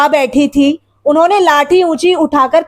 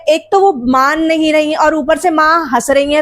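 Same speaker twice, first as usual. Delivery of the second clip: plain reading voice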